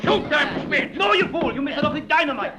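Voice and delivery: high, alarmed yell at a henchman; frantic, angry shout; furious orders. Acted. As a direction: yell at your bungling gunman mid-fight. Villain yelling orders in a fight.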